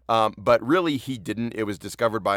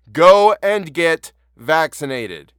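loud, slow, word-by-word command; emphatic urging; 7 semitones higher, 12 dB louder, much slower than usual. Real, not acted. Host urging listeners to get the vaccine, loud and emphatic. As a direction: hammer each word loudly and slowly.

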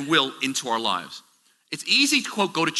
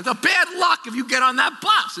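mother screaming; 10 semitones higher than usual. Retold or imitated. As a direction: imitate a frantic mother forbidding it. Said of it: "she's screaming at me".

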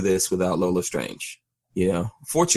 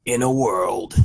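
epic trailer announcer opening; "In a world" trailer narrator; movie trailer voice; slower than usual. Shown as a demonstration. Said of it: "you gotta say it with a movie voice".

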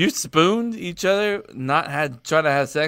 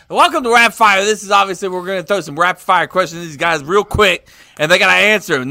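game show host; 3 semitones higher, 4 dB louder than usual. Acted. Host opening game segment.

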